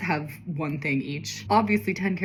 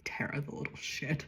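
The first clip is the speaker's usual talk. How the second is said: a snide little whisper under the breath; whispered aside; 2 semitones lower, 10 dB quieter than usual. Real, not acted. The reader whispers a jab at Tara. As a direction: mutter it as a private jab.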